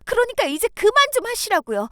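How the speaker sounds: high, fast, fed-up cry to stop; finally snapping after holding it in; angry outburst. Acted. Telling her sister-in-law to stop, angry and fast.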